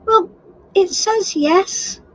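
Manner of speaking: usual speaking voice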